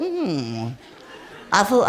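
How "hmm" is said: low, very soft hum; a quiet low pondering hmm; muttered low hmm; thinking hum. Retold or imitated. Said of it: "and I thought".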